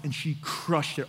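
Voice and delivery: ordinary talk, nothing marked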